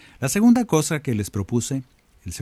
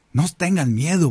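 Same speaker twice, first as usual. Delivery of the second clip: Jesus reassuring; 6 semitones higher than usual. Retold or imitated. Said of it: "Jesús le dice".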